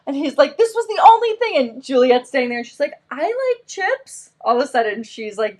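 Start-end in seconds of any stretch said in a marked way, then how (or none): 0.6-1.6 high-pitched mock complaint; indignant child. Son answering mom about the chips, mildly indignant. 3.1-4.3 put-on high, soft, slow child voice; agreeable little girl. Little sister piping up about chips, agreeable. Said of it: "the agreeable one".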